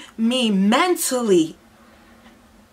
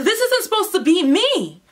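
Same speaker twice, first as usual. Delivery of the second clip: ordinary talk, nothing marked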